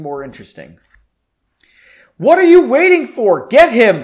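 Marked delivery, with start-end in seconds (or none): 2.2-4.0 high, loud screamed order; furious leader yelling at his troops. A narrator's reading. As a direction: scream the command at the soldiers. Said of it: "Frederick screamed".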